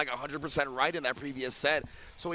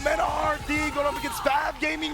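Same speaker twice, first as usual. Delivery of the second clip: big booming hype call, building; booming prize announcer; 10 semitones higher, 3 dB louder than usual. Acted. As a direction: announce the grand finals like a ring announcer. Hype grand finals announcement.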